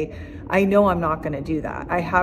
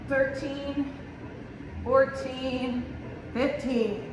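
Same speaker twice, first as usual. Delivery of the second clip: counting reps mid push-up, strained; doing push ups; 5 semitones higher, 6 dB quieter, slower than usual. Real, not acted. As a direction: count each rep between push-ups. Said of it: "I'm gonna do ten push-ups".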